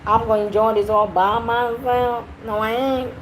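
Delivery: Southern-belle style accent, played for fun. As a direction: ham up a Southern twang. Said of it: "Is this southern Liberian accent?".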